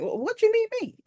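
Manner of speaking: high, loud mock disbelief; comic squawk of disbelief; incredulous retort. Imagined baffled reply to the story.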